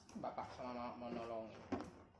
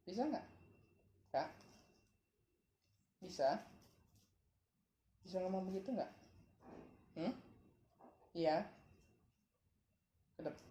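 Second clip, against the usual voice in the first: ordinary delivery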